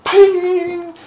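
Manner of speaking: piercing high cartoon "Ping!" sound effect; sudden shrill cartoon clang. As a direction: ring out a Roadrunner-style pickaxe ping, very loud. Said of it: "Like in the Roadrunner cartoons".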